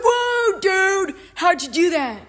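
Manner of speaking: loud, high whoa-dude yell; amazed shout. Retold or imitated. Recapper voices Grover amazed at Percy, shouting. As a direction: yell it in hyped-up astonishment. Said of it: "Grover's like".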